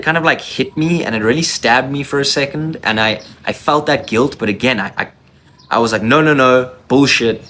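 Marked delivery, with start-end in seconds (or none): 6.0-7.4 louder, heated retold no no no; angry dismissal. Brushing off his girlfriend's denial, a heated retold outburst. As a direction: shut her down hard.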